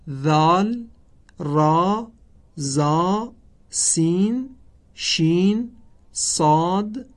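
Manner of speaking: each Arabic letter name said slowly and apart, with a pause after each; a tajwid teacher naming the alphabet one by one. Shown as a demonstration. Teacher recites the Arabic alphabet names to students, measured and deliberate.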